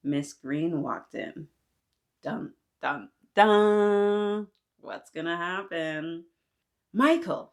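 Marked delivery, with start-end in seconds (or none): 3.3-4.5 loud, drawn-out suspense note; dramatic dun. Acted. Narrator builds suspense before the teacher's entrance, loud drawn dun. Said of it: "dramatic sound effect". 6.9-7.5 high, loud sharp call of a name. Acted. The angry teacher calls Michael, loud and high. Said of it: "she called".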